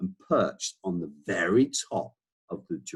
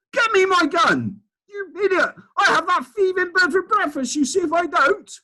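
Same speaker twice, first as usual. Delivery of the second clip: much higher, louder outraged bellow; furious Duke shouting; 7 dB louder than usual. Acted. Duke screaming for his gun at the bird, much higher furious shout. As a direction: rage like a robbed old aristocrat. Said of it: "the Duke was shouting".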